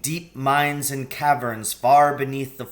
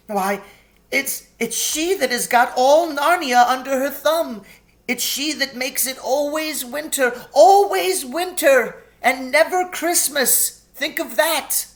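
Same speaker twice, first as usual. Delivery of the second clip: distraught faun; 13 semitones higher, 6 dB louder than usual. A narrator's reading. Tumnus describing the Witch.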